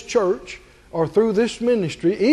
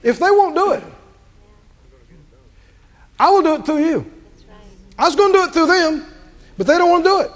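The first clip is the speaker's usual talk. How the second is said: raised, higher, insistent divine voice; God voiced; 8 semitones higher, 4 dB louder, faster than usual. Preacher voicing God telling Moses his new plan, raised and insistent. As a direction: lay it out like God changing plans. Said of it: "But he's saying".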